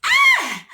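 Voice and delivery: sharp high scream, loud. Retold or imitated. Child opening kennel, piercing high scream. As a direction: shriek as the dogs come.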